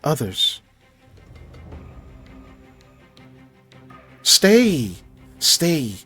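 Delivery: eerie plant voice hissing commands; creeping vine calling out; slow hissing strangling vine. Acted. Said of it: "You hear the plant say".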